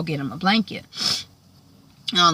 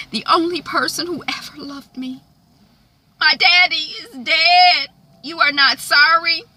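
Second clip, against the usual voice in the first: high, mounting to loud grief; hammed-up wounded outburst; anguished accusation; 11 semitones higher, 5 dB louder than usual. Melissa grieves her daddy and blames Jeff, anguished accusation.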